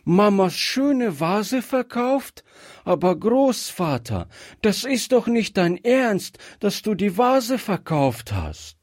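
high-pitched, disbelieving protest; dismayed little girl who can't believe it; horrified child. A narrator's reading. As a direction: plead aghast in a high child's voice. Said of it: "rief es ganz entsetzt".

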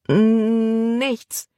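high, stuck on the first sound; caught-out, hesitant stutter; stammered. A narrator's reading. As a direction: stammer out a reply, drawing out the opening N. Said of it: "stotterte Libby".

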